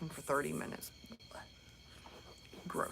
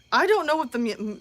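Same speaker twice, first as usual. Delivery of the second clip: ordinary delivery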